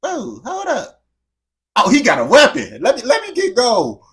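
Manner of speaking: high, loud comic alarm; hammed-up panicked backpedal; mock panic. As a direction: play a startled, hasty retreat. Acting out a panicked retreat from armed person.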